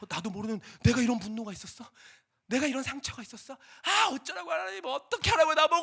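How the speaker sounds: high, loud, breaking into a yell; anguished shout. Retold or imitated. Jonah screaming at God in despair, loud and high. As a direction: scream your frustration at God.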